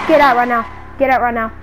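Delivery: loud firm command; stern demand to leave; ordering out. Acted. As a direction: throw her out. Ordering stalker out.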